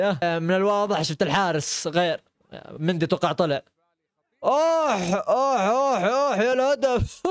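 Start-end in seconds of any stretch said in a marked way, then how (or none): 4.4-7.2 higher, excited oh-oh at a goal; laughing oh at the goal; excited groan. Real, not acted. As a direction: react to the goal with glee. Reacting to goal conceded.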